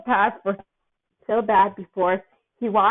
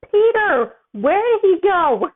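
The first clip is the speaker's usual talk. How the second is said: calling out; 9 semitones higher, 5 dB louder, slower than usual. A narrator's reading. Tony searching for missing teen.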